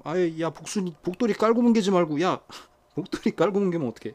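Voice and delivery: high, loud sharp scolding; scolding pet. Real, not acted. Owner scolding 복순이 for squashing 복돌이, loud high scold. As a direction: stop the pet right now, loudly.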